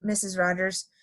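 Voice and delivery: plain reading voice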